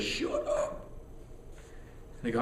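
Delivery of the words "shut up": high breathy whisper of the inner voice. Retold or imitated. His own inner voice hushing him in front of [name], in a high whisper. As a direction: scold yourself silently, squeaked. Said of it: "my inner voice goes".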